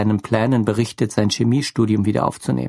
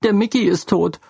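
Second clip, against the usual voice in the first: a touch of sobbing, higher; 8 semitones higher than usual. A narrator's reading. Lene tells Theo of the death, sobbing. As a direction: choke it out through tears. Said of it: "schluchzte Lene".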